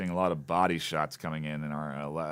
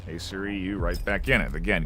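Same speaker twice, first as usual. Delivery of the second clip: esports caster; 4 dB louder than usual. Real, not acted. Live play-by-play of a match.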